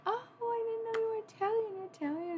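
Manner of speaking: imitating relatives. Extended family greeting her friend, high imitation. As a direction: sing it like a delighted aunt. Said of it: "would be like".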